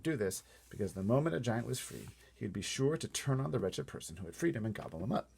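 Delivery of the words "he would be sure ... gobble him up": usual speaking voice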